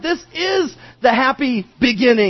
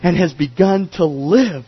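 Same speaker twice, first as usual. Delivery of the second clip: pleading; 7 semitones lower than usual. Acted. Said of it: "he began pleading with him".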